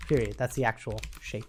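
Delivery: usual speaking voice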